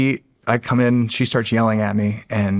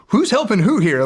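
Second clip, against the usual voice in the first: incredulous; 9 semitones higher than usual. Retold or imitated. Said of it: "I was just like".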